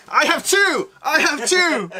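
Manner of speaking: high, loud, gleeful boast; excited shout. Real, not acted. Excitedly declares owning two swords.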